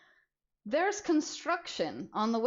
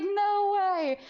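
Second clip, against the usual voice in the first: surprised; 11 semitones higher, 5 dB louder than usual. Retold or imitated. Worker shocked at shopping cart.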